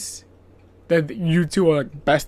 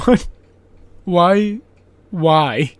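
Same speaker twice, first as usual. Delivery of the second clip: louder incredulous whys; baffled; 7 dB louder, much slower than usual. Retold or imitated. Host baffled by the edit, loud incredulous whys. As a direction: ask why in disbelief.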